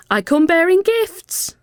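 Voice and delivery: high, loud and excited; high cheery announcement; cheerful. A narrator's reading. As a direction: announce your gifts with glee. Mel calling cheerfully.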